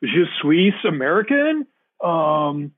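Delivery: put-on slow, high, clumsy American accent in French; mock a nervous student with a thick American accent in French; a high school kid in French class stumbling along in an American accent. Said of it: "they looked more stupid 'cause they're like".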